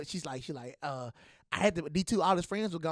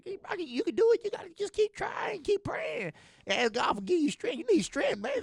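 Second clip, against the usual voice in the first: high sing-song imitation of the girls; lightly mock the girls cheering him on, very high; imitating the girls urging Brock on in a very high voice; mock high encouraging voice; 13 semitones higher than usual.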